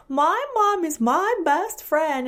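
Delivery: high, slow put-on voice mocking a child; mock silly voice. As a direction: tease him in a silly sing-song.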